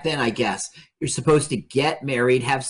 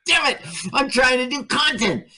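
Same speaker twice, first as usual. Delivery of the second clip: mock frustration, voice shooting up; frustrated outburst; 11 semitones higher than usual. Real, not acted.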